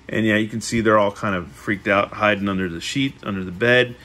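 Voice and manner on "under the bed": ordinary delivery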